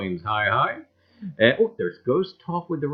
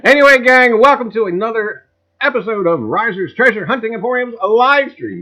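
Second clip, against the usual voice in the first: loud, higher show-opener welcome; hyped host intro; 6 semitones higher, 10 dB louder than usual. Acted. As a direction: open the stream like a game-show host. Repeats opening greeting.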